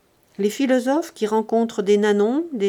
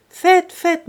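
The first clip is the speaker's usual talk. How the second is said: loud, higher jovial reply; hearty call; 9 semitones higher, 10 dB louder than usual. Acted. President calls back to Grandet on the stairs, loud hearty reply.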